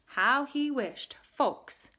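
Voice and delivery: plain reading voice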